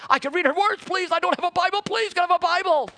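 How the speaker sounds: high, eager child's plea, voiced by the preacher; pleading little girl. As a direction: beg like a twelve-year-old who wants a Bible. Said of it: "She just was saying".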